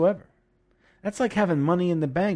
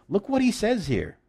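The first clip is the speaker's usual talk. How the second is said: ordinary talk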